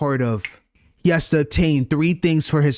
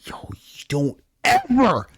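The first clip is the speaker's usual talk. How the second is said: hushed, slow whisper; slower than usual. Real, not acted. Player scolding Shinku, slow hushed whisper.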